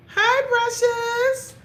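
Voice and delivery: high, loud drawn-out hi; excited greeting. Real, not acted. Greeting Precious in the chat, a high, loud, slow hi. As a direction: stretch the hi out in delight.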